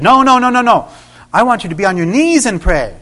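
loud, insistent repeated no; firm command. Retold or imitated. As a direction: put your foot down, loud and high. The Lord answers firmly.

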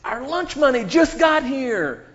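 bully taunt. Retold or imitated. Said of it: "They walk up and they say".

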